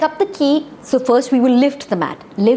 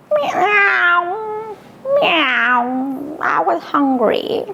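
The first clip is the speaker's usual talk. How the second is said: kitten voice; 7 semitones higher, much slower than usual. Acted. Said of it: "Stripes the cat answers".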